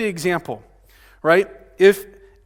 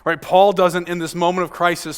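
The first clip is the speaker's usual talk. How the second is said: ordinary talk, nothing marked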